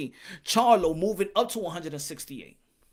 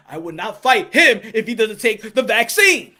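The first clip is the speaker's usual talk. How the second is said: mock fighter refusal; 8 dB louder than usual. Repeating the mocked fighter's line.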